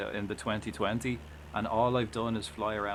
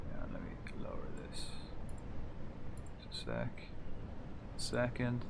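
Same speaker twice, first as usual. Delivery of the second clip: whispering; 8 dB quieter than usual. Real, not acted. Lowering volume, whispered aside.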